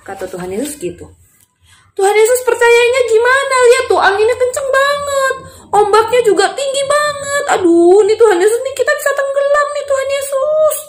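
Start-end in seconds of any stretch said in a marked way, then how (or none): 2.0-10.8 very high wailing protest; acted high, desperate complaint; panicked pleading. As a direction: whine in panic as scared disciples. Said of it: "minta tolong sama Tuhan Yesus".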